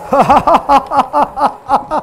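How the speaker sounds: long staccato stage cackle; theatrical villain's ha-ha-ha; villainous laugh. Acted. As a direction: laugh in a long run of separate bursts. Villain laughing between declaimed lines.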